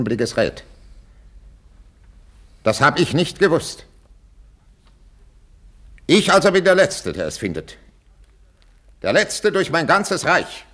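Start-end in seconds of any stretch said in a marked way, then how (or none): 2.6-7.1 very loud, high outburst; outraged cry. Acted. King finding he was the last to know, outraged. 9.0-10.7 slow, loud, high lament; outraged cry. Acted. King humiliated before his whole realm, outraged cry.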